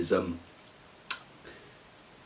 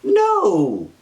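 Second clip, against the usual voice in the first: squeaky stubborn refusal; high mock protest; 11 semitones higher, much slower than usual. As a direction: wail each no way up high. Man refuses, voiced high.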